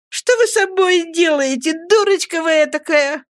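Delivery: high, teasing mother's voice with a touch of amusement; lightly amused scolding, pitched high; смеясь. A narrator's reading. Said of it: "смеясь, сказала матушка". (ласково-насмешливо, высоким голосом)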